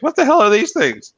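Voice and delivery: high, loud mock bafflement; baffled guest. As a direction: play a foreign guest shocked by crawfish. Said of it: "they're gonna be like".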